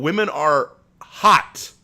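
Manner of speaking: ordinary delivery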